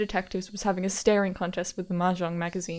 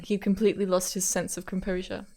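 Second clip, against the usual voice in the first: ordinary talk, nothing marked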